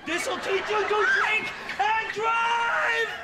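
high, drawn-out shout; angry fight yell. Acted. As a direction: yell it while landing blows. Yelling while fighting.

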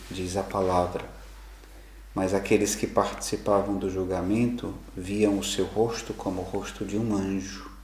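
ordinary delivery

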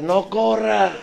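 high, loud mock cry of a mother; mock mother's shout. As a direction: yell it like a panicked mom. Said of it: "mi mamá alcanza a gritar". (grito de mamá asustada, actuado)

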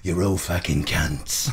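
low, slow exaggerated London accent; forced London accent. Insulting a hotel room on request, forced low London accent. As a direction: lay the London accent on thick and drag it out. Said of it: "I really forcibly Londonized it".

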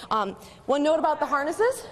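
normal talking voice

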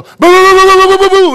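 loud, high rapid-fire gun imitation; booming play-gunfire burst; machine gun noise. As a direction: imitate a heavy machine gun at full volume. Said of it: "fifty caliber machine gun ... I could go".

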